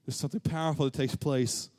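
normal talking voice

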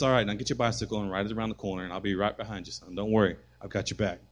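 calm him down quietly; dad tells his son he's got his back; reassuring dad. Retold or imitated.

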